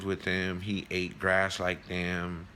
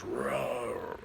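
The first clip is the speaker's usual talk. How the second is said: soft, throaty storyteller's roar; mock roar, held back in volume; imitated tiger roar; 2 semitones higher, 5 dB quieter than usual. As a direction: give a restrained tiger roar. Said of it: "and he roars".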